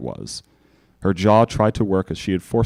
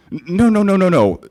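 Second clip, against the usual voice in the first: high, loud, rushed stammering no's; frantic denial; 10 semitones higher, 5 dB louder than usual. Acted. Doctor panics under the princess's stare, frantic. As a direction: backpedal in a panic. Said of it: "His hoof waved ... with a frantic state".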